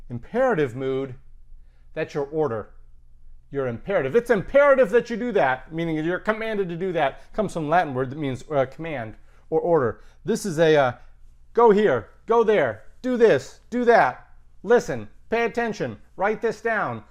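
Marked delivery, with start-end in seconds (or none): 4.1-5.7 louder, higher, insistent; raised, emphatic command voice; insistent order. Shown as a demonstration. Acts out an imperative example. 11.5-17.0 string of loud, separate orders; louder, punchy example orders; commands. Shown as a demonstration. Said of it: "Those are the imperative mood".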